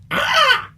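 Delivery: very high shrill squawk; bird cry. Pretend. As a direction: let out a reflexive bird screech. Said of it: "Sorry, force of habit".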